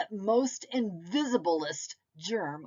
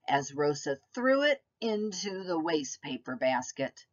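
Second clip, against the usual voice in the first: usual speaking voice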